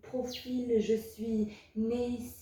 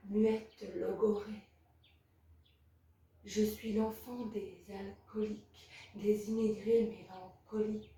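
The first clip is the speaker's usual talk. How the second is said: softer, hushed closing verse; subdued poem ending; 2 semitones lower, 5 dB quieter than usual. Acted. Child of alcoholics closing a poem on exile, subdued recital. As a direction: let the recital sink away, slowing on the last words.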